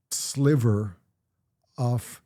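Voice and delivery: ordinary talk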